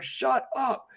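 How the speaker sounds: King Arthur silencing the peasant, mock curt. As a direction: cut him off like an irritated king. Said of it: "King Arthur's like".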